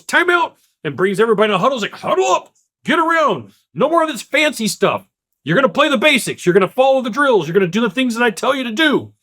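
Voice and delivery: high, excited coach rallying the team; coach calling a timeout. Retold or imitated. Coach rallying struggling players in a huddle, high excited call. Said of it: "the coach goes".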